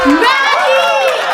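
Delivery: high excited call; excited shout. Real, not acted. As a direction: announce her name loudly. Announcing friend's arrival.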